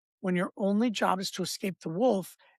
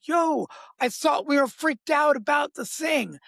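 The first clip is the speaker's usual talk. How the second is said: panicked brain; 7 semitones higher than usual. Retold or imitated. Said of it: "brain that is running in fight or flight goes".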